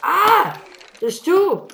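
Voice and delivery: high, loud yelps of shock; alarmed surprise. Real, not acted. Gamer shocked by mobs spawning in his pen, yelping high. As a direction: panic as the mobs keep appearing.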